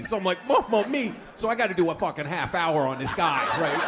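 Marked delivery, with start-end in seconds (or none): 0.5-1.1 high mocking stammer; mimicking stutter. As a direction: parrot the heckler's stutter back. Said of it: "has a messed up stutter".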